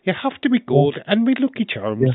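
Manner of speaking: high-pitched Irish leprechaun voice. Acted. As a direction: pitch it up like a cereal-advert leprechaun. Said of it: "you gotta be a leprechaun".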